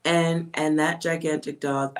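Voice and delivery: usual speaking voice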